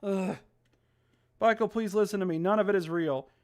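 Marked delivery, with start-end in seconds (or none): none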